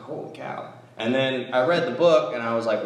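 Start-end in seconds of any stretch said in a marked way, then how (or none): 0.0-0.7 awed holy cow. Retold or imitated.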